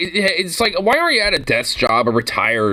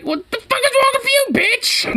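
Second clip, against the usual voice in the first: a much higher shrieking parody voice; shrieking mock cop. Viewer mocks the officer in a squealing high voice.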